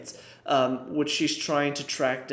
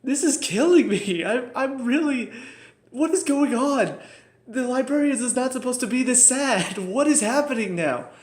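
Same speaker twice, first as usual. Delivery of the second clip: much higher, louder anguished fan outcry; overwhelmed fan; 10 semitones higher, 5 dB louder than usual. Real, not acted. Fan reacting to the sad scene, overwhelmed outcry. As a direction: cry out like the show is breaking your heart. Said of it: "I'm just like".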